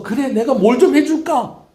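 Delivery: high, loud, eager offer; overjoyed God. Acted. God delighted by the people's pledge, overjoyed. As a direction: burst out with delighted generosity. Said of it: "하나님이 너무 기뻐서".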